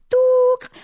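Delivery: imitated answering machine beep; a high, loud beep sound; mock voicemail tone.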